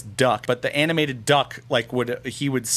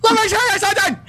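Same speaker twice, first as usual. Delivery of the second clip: shouted high character voice; Fire Marshal Bill; 6 dB louder, faster than usual. Retold or imitated. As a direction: bark it out like a manic fire marshal. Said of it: "Is that, is that what your impression?".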